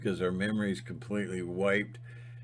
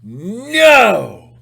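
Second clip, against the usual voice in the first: loud, much higher stand-your-ground NO; defiant shout; 10 semitones higher, 16 dB louder than usual. Retold or imitated. Mike showing Timmy how to refuse, loud shout. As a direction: bark it out like a man taking a stand. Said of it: "you stand up like a man and you tell him".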